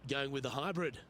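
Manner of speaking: calm golf play-by-play; measured broadcast golf commentary; golf commentator. Acted. Golf game commentary held throughout.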